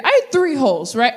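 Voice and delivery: normal talking voice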